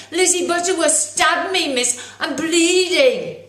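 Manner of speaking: high, loud schoolboy wail, slowing at the end; whiny tattling boy. Acted. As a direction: tattle on her with a wounded whine. Boy telling on classmate.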